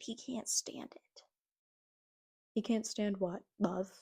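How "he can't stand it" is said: whispered, slow mock warning. Vlogger mocking a homophobe, whispered warning. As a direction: hiss it like a spooky secret.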